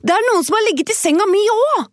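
high, fast excited dwarf call. Acted. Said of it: "Nå kom den andre og ropte".

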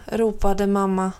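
plain reading voice

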